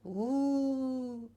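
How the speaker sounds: high, hollow ghostly moan. Pretend. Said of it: "This is Ghost Marco".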